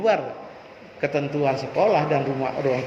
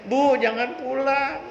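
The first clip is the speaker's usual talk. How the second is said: put-on whiny child; 7 semitones higher, slower than usual. Child begging mother at school.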